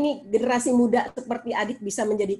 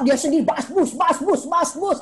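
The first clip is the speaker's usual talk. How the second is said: imitating nagging; 3 semitones higher than usual. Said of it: "Jangan merokok".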